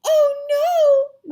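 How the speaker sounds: high, very loud dismay; mother crying out. A narrator's reading. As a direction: cry out in alarm for your child. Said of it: "my mother cried".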